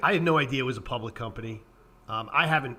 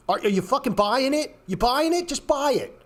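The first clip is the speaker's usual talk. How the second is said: exasperated rant; 10 semitones higher than usual. Real, not acted. Ranting at indecisive traders.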